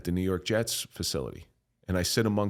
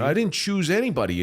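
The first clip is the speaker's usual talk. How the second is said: high, louder mock protest; acted indignant protest; 10 semitones higher, 5 dB louder than usual. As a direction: plead your case, pitched right up. Protesting to devil in story.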